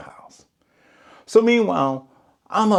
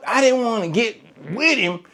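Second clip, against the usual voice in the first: normal talking voice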